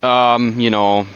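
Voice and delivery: ordinary talk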